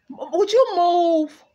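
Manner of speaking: loud, high and slow; loud high move. Real, not acted. Telling the cat to move, loud high and slow. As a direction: shoo the cat off.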